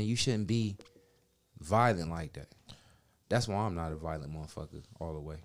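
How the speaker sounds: ordinary delivery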